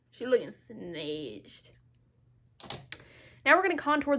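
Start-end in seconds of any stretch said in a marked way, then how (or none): 0.1-1.7 low, slow, drawn-out praise; hammed-up hype. Real, not acted. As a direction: stretch it out low, savouring the look. Hyping her contour after whoop.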